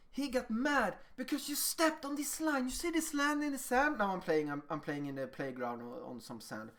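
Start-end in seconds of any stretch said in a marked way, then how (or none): 0.1-4.0 put-on higher-pitched, feelingly explained to a child; emphatic explaining. Interpreter telling a child why Alex got mad, emphatic explaining. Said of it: "I'm really emphasizing this feeling".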